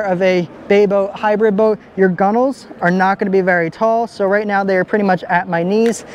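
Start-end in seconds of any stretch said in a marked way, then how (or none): none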